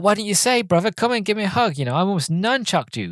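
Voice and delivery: high, matey greeting, acted out; imitated excited welcome. Chaz welcoming his protege's best friend, imitated excited high greeting. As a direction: greet a long-lost mate, pitched well up.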